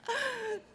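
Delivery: light high-pitched laugh, soft. Real, not acted. Hearing the email story, a soft high laugh.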